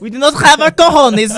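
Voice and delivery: put-on high, loud comic foreign accent; Borat. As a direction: declare it as a hammed-up Borat. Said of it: "This message brought to you by Borat".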